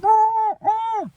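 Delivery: very high startled squeak; mock startled yelp. Spy shakes the buried leg, very high startled yelps. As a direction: jump at the leg in the snow.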